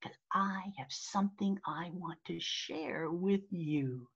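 hushed, secretive whisper into the mic; close-up whisper. Shown as a demonstration. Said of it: "I moved my face really close to the screen".

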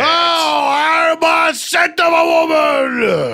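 hammed-up, high drawn-out shouting; wild mock courtroom yelling; Al Pacino. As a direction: bellow it like an over-the-top movie star.

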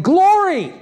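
high, loud joyful cry of Glory!; exclaimed glory. Shown as a demonstration. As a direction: burst out with Glory! like an exclamation point. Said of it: "It's chabod with an exclamation point".

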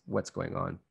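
usual speaking voice

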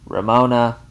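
loud call across the house; shouting for the child; shouted name. A narrator's reading. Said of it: "Eula shouted".

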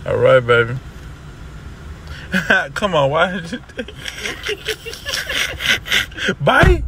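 laughing loud and high; giggling through a goodbye. Real, not acted.

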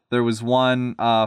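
plain reading voice

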